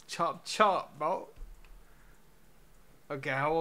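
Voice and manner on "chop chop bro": impatient. Real, not acted. Urging the game to hurry.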